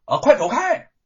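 high loud order to get lost; loud shout. Acted. Gatekeeper shouting at the young man to leave. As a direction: yell him away from the gate. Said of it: "家丁大声的说".

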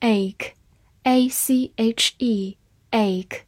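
slow, letter by letter, then the word; slow spelling drill. Shown as a demonstration. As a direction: spell it out slowly for the class. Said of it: "spelling out a word".

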